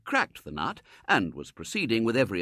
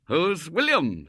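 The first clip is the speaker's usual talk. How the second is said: slow, higher wild outburst; 7 semitones higher, 6 dB louder, slower than usual. A narrator's reading. Husband to wife, a touch of wild bluster. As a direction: bluster it out in alarm. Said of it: "said Mister Brown wildly".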